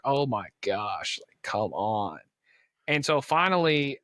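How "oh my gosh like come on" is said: low, slow, dragged-out exasperation; mock weary impatience. Teller reliving the longest wait as a kid, mock exasperated. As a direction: act out a bored wait, pitched down and dragging. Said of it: "that being the longest time".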